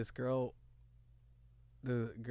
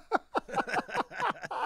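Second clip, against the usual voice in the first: high-pitched real laughter; laughing; 4 dB quieter than usual. Real, not acted. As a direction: crack up at your own story. Laughing at his own story.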